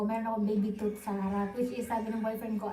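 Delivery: ordinary talk, nothing marked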